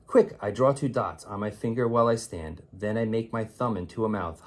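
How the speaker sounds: ordinary delivery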